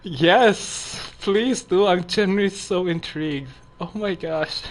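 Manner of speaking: put-on high, loud gushing girly voice; girl voice. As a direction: squeal it like an excited girl. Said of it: "I'm starting to do my girl voice".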